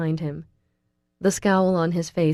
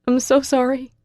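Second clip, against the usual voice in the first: higher, tearful repeated sorry; tearful apology; 7 semitones higher, 6 dB louder than usual. A narrator's reading. Girl repeating her apology to Russell in tears, tearful. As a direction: say it again, more broken. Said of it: "I whisper as hot tears".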